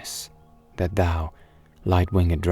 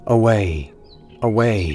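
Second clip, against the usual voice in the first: louder, falling cry within a recitation; exclaimed verse; 4 semitones higher, 6 dB louder than usual. Acted. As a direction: call out each word as a poetic exclamation. Poem's exclamation of flight.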